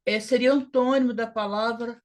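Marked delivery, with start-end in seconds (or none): none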